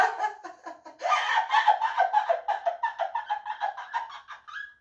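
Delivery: high shrieking laugh fit; helpless laughter. Real, not acted. Cracking up over "domly", helpless high laugh. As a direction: lose it completely laughing.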